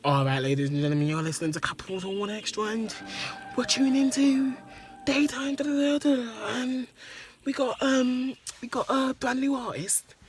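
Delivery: high-pitched weekday afternoon DJ; hammed-up, high radio announcer voice; radio presenter impression. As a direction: put on a daytime radio host introducing a new artist. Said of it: "some next weekday four P M impression".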